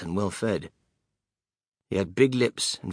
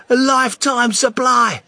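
envious; 12 semitones higher, 10 dB louder than usual. Acted. Envious boy continues.

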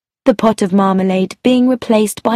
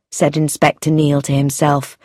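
usual speaking voice

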